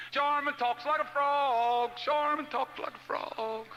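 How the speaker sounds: high, slow, drawn-out taunt; singsong playground mockery, stretched out; kids teasing. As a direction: chant it like jeering schoolkids. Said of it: "She talks funny".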